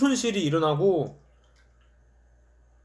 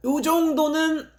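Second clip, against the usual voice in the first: high coy plea; 9 semitones higher, slower than usual. Real, not acted. Justifying diet cheat.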